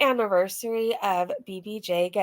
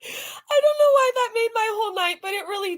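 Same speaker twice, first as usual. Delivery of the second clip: high voice breaking with laughter; talking through giggles; laughing; 11 semitones higher than usual. Real, not acted. Telling viewers the clip made her night, giggling.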